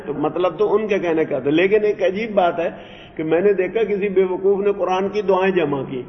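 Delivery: ordinary talk, nothing marked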